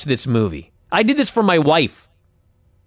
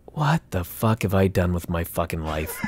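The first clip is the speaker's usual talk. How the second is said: inner thought; 12 semitones lower, 8 dB quieter than usual. Retold or imitated. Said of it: "I'm thinking".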